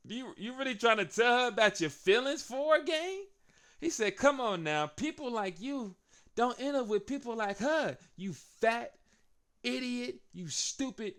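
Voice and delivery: mock him like a heel; mocking taunt. Heel taunting the big wrestler, sneering. Theory mocking Otis, voiced.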